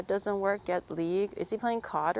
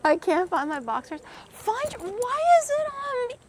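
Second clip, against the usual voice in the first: whiny Dave; 6 dB louder than usual. Retold or imitated. Mocking Dave's complaint again.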